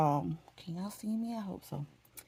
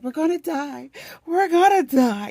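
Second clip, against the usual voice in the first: high panicked wail, growing louder; hammed-up tearful doom; retold bawling panic; 8 semitones higher, 5 dB louder than usual. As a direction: cry it out in mock terror, dragging the last one. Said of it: "I'm, I'm bawling again".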